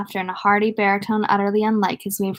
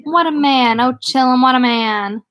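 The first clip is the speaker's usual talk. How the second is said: louder, higher mock worship; awestruck; 4 semitones higher, 7 dB louder than usual. A narrator's reading. Richie salaams over the table, awestruck and hammed-up. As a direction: heap on theatrical awe. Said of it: "in a trembling, awestruck voice".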